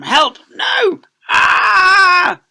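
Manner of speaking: very high, loud cries; mock panic. Children fleeing Miss Spick's trike, loud high screams. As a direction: scream as you flee. Kids chased by scooter.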